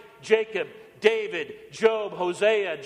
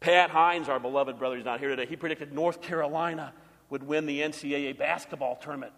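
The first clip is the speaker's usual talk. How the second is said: lower, softer wry jab; teasing aside; 6 semitones lower, 3 dB quieter than usual. Acted. Preacher teasing an absent brother, quietly wry. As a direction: drop the voice for a sly joke.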